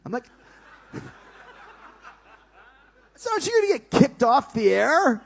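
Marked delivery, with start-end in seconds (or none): 3.2-5.2 high, loud disbelieving question; incredulous question. Retold or imitated. Arguing with Vince over the naked segment, high and loud. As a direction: push back, pitched high in disbelief.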